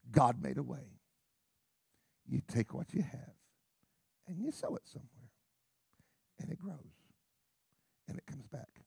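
usual speaking voice

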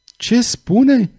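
high, startled question; moved and alarmed; agitated, emotional. A narrator's reading. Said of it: "întrebă emoționat Charles".

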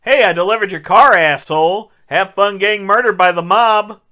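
hammed-up parting shot; mock taunt. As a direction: toss the insult out with cocky volume. Voices Charlie taunting his boss.

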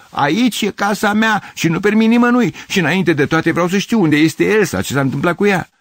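high, loud, insistent outburst; indignant, agitated shouting; heated protest. A narrator's reading. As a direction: demand answers at full voice. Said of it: "protestă Gavrilescu".

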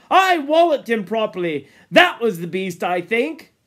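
loud, proud boasting; excited. Acted. Ralph talking on about the beast, excitedly. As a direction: keep boasting, full of the hunt. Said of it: "Ralph talked on excitedly".

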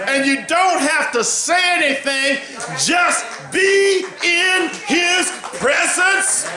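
preacher shouting in joy; preaching at full force. Real, not acted. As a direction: lift the room with a ringing call. Preacher rising to whooping shout.

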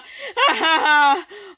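high happy laughter. Real, not acted. Friend laughs with joy over the best vacation. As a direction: laugh out in delight.

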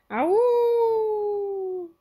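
loud, very high animal howl; sustained wolf-like howl; long high howl. Acted. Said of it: "Howling filled the hall".

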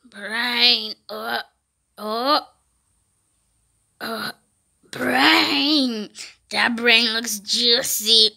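low, slow zombie groan; play zombie voice. Pretend. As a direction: drag it out like a hungry zombie. Voices a brain-hungry zombie.

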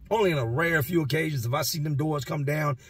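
usual speaking voice

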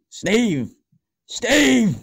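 high, loud call across the house; shouting Steve. Acted. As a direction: yell his name to get him to answer. Calling Steve, getting louder.

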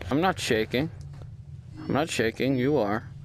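nervous gamer muttering to himself; scared quiet. Real, not acted. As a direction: hush it, really frightened. Scared, talking softly to self.

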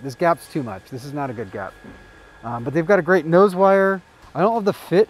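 muffled by cloth mask; speaking behind mask; through a face mask. Real, not acted. Seen 0: Camo mask over nose and mouth throughout labelled speech.

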